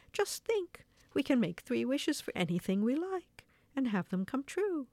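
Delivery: high, swooping storybook excitement; excited exclamation. A narrator's reading. Old woman imagining three wishes to her husband, excited. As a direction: marvel aloud at the possibilities. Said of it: "exclaimed the little old woman".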